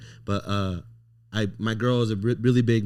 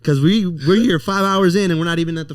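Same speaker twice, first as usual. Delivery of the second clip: loud, higher-pitched orders; insistent retold scolding; 7 semitones higher, 7 dB louder than usual. Boyfriend to girlfriend five hours into queuing, loudly insistent. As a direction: push it out hard, retelling your own urgent warning.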